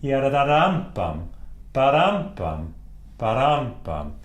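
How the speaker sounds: "badampam" stressed on dam, falling on pam; rhythmic sung-like "badampam", next-to-last syllable punched; musical cadence. Shown as a demonstration. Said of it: "we need the cadence".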